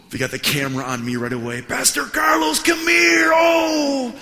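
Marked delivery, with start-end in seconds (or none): 1.6-4.1 very high, loud showy shout; gushing famous pastor. Retold or imitated. Famous pastor greets visitor on camera, mock gushing. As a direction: holler a hammed-up welcome for the camera.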